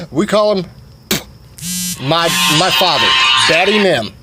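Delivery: normal talking voice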